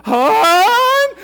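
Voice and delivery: a very loud, high call down the stairs; imitating the wife screaming for her husband; wife's yell. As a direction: yell for help from another floor. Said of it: "that particular tone in her voice when she yells down".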